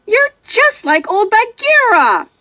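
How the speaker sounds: put-on high boy's voice, hurt and yelling; a betrayed child lashing out; boy shouting. A narrator's reading. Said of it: "he shouts".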